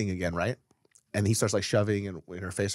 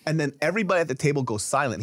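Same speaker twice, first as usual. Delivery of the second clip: usual speaking voice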